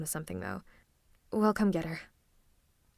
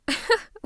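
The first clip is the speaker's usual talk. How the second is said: short, high, louder laugh; scoffing villain laugh; 13 semitones higher, 6 dB louder than usual. Acted. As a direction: laugh off the hero's challenge. Scoffs at hero's threat.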